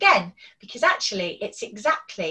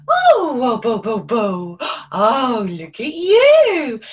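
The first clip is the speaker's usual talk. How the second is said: motherese; 8 dB louder, slower than usual. Shown as a demonstration. Grandparent cooing to a baby, loud swooping sing-song. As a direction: coo at the baby like a grandparent, stretching it slowly. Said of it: "their voice is going up and down and up and down".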